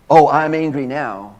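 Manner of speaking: a sudden loud burst of fake anger; hammed-up loud angry snap; mock angry outburst. Shown as a demonstration. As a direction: blurt it like a hothead losing control. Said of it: "You see the difference?".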